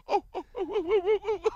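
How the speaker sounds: high, laughing yelps of pain; shouted high oh oh oh through laughter; pained yelping. Retold or imitated. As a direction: imitate a guy hopping around in agony, yelping. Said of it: "He was hopping around going".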